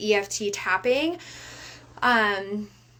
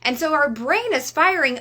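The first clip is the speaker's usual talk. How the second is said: ordinary talk, nothing marked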